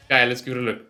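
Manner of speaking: ordinary talk, nothing marked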